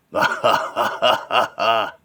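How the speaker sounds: loud, deliberate evil laugh; villain's mocking laugh. Buried villain laughing at the hero, mocking. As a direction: laugh in separate, punchy bursts.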